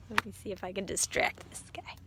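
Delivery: soft unvoiced aside; hushed aside; whispered aside. Real, not acted. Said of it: "aside whispered to someone".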